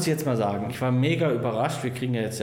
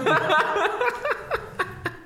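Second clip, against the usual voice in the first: squealing burst of laughter; high-pitched laugh. Real, not acted. Reacts to silly question.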